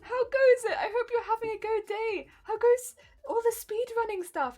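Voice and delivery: high, loud, bubbly hello; excited greeting. Real, not acted. Greeting Effigy in chat, high excited and loud. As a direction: greet them with delight.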